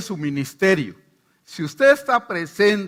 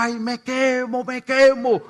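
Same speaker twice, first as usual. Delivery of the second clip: higher, slow cries of burning, growing louder; screaming possessed woman; 6 semitones higher, 4 dB louder, slower than usual. Retold or imitated. Possessed woman under his hand: higher, slow burning cry. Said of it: "ella gritaba".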